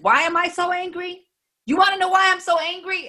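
loud, high-pitched imitated outrage; angry retort. Angry heckler turning on the person who asked why, angry retort. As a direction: throw the question back, voice shooting way up. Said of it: "Why are you so angry?".

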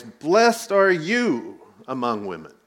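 loud, earnest blessing spoken over a guest; loud proclamation. Retold or imitated. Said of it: "this is what she said in a loud voice".

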